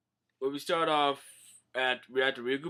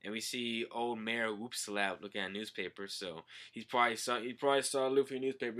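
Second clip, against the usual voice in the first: usual speaking voice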